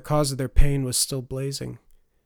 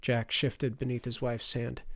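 ordinary delivery

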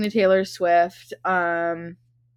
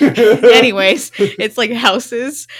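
laughing; 4 semitones higher, 11 dB louder, much slower than usual. Real, not acted. Laughing after a slip.

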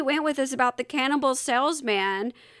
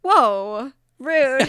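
mock offended; 7 dB louder than usual. Mock offence at co-host's joke.

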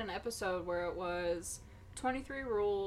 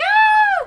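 sudden loud yelp of fright; startled scream; 20 dB louder than usual. Retold or imitated. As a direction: shriek as the wasp buzzes past. Wasp flies past face.

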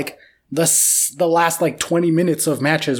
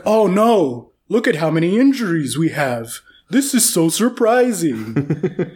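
drawn-out sarcastic fake shock; mock dismay; slower than usual. Host mocks clubs complaining about injuries, sarcastic mock dismay. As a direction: imitate smaller clubs lamenting, dragging out the final words. Mocking clubs' feigned surprise.

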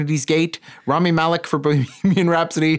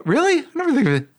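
surprised; 10 semitones higher, faster than usual. Real, not acted. Surprised Dafoe has NY accent.

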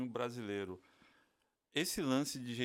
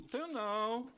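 laid-back baiana mom; 8 semitones higher than usual. Retold or imitated. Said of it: "Aí a mãe".

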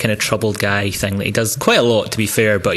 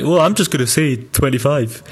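mocking impression; 4 semitones higher than usual. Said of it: "That's a terrible impression of me".

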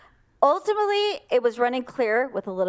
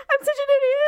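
high, louder, crying voice; tearful self-blame; 4 dB louder, much faster than usual. Retold or imitated. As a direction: wail it into your husband's shoulder. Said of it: "and I was just like".

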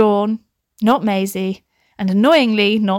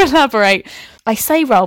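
ordinary delivery

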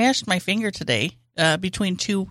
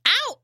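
mock pain yelp, pitch jumping up; high ow. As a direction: yelp of pain, much higher. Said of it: "and then going".

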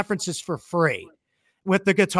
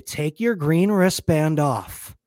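low, measured, deliberate request; very calm instruction; 5 semitones lower, 4 dB quieter than usual. Retold or imitated. Talking buddy into lending his wristband, very calmly. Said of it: "Very calmly".

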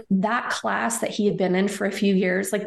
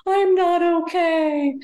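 high, slow mock wail; retold sobbing; 9 semitones higher, 3 dB louder, slower than usual. Grieving patient answering her gynecologist, retold high tearful wail. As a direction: draw it out high, imitating a tearful breakdown. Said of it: "I just start sobbing again".

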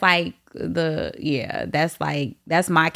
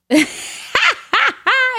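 high squealing laugh; 5 dB louder than usual. Real, not acted. Host laughing at her own dry spell, squealing. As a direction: burst into a loud, high laugh.

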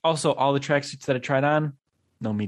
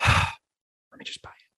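resigned sigh; slower than usual. Retold or imitated. Giving in to an unwanted purchase.

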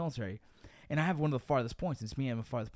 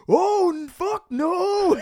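high, loud panicked scream; 12 semitones higher, 13 dB louder, much slower than usual. Retold or imitated. Retelling how he screamed at the lights, loud panicked scream. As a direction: yell it in terror, much higher and louder. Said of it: "I swear to you, I screamed".

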